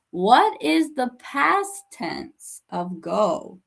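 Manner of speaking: very slow, every word spaced out; slow guided question. Shown as a demonstration. Teacher guiding a student to the past tense, slowly. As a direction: ask it slowly so the student can think. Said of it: "ask a guided question".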